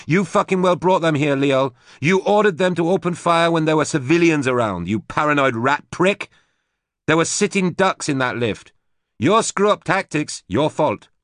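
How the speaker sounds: high, loud furious tirade; angry shout. A narrator's reading. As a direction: tear into him, yelling. Susie yelling back at Leol.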